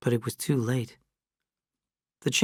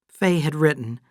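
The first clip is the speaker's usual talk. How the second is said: usual speaking voice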